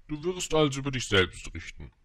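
a hint of a pronouncing king. King instructs the little prince to judge himself, lightly acted.

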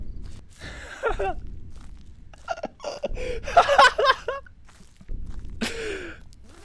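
long, breathless, high laughing fit; real relieved cackling; laughing after a scare. Real, not acted. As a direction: laugh off the fright helplessly.

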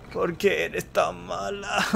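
pleading repeat. Acted. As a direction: stretch it out like a wounded child. Repeating the line, more pleading.

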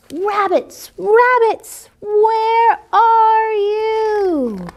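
put-on hungry wolf boy giving a long howl. A narrator's reading. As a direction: howl the words out like a wolf at the moon. Wolf boy howls louder.

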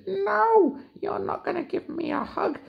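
very high cheeky refusal; gingerbread man voice. Acted. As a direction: tease in a tiny high voice. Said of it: "And he said".

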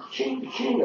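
playful high ching of money spent; cash register ching. Retold or imitated. Money-spending sound effect.

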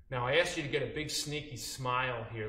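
normal talking voice

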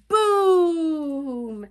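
loud, long-drawn boom; thunder boom. Acted. Imitating thunder.

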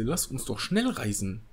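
ordinary talk, nothing marked

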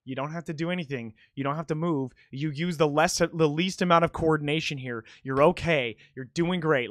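hushed, reassuring inner voice; acted self-soothing. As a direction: talk yourself down calmly, as if mid-performance. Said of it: "where I'm just like".

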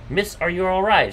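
understated storybook shout; concerned shout. A narrator's reading. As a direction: half-shout it as a worried hero. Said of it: "shouted Mikoto".

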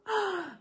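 sharp high gasp. Real, not acted. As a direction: gasp in sudden alarm. News of forgetting the gown.

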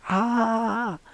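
high, held-back yell; stifled high scream; mock scream. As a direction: scream but not too loud. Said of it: "Elle crie".

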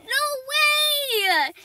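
usual speaking voice

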